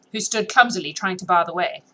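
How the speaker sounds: ordinary delivery, nothing marked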